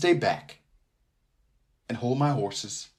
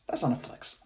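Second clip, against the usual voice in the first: hushed, breathy repeat of the line; whispered aside; 8 dB quieter than usual. Acted. Host repeating himself to listeners in a whisper. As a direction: say it again under your breath.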